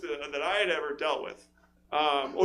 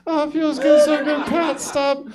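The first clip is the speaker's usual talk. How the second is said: high, loud, slow breathy moans of pleasure; moaning woman in bed; 10 semitones higher, 9 dB louder, slower than usual. Retold or imitated. Comic mocking the woman in bed, high slow moaning. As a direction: ham up a moaning lover. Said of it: "and she goes".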